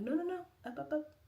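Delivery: high little hurry-up voice; self-coaxing. Retold or imitated. Woman stopping herself getting too sad, high coaxing. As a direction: coax yourself upward in a high pitch. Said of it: "too sad".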